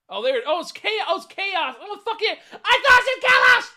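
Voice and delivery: real high-pitched, very loud outburst; shouted realisation. Real, not acted. Streamer suddenly realises the misread name, shouting in disbelief. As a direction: yell it out, climbing to a screech.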